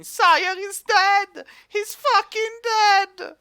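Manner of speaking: slow, high-pitched mob cry at talking volume; mock yelling. Mass of dwarves met near the tavern, mock yelling. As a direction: draw each word out like distant yelling dwarves. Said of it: "dwarves just yelling".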